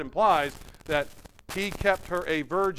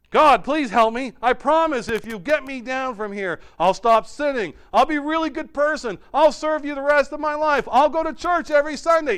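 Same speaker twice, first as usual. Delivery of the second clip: pleading; 10 semitones higher, 8 dB louder than usual. Acted. Jack bargaining with God.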